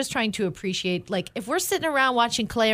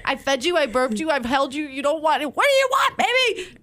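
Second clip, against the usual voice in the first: exasperated pleading; 7 semitones higher than usual. Retold or imitated. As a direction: plead with a fussy baby. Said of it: "I'm like".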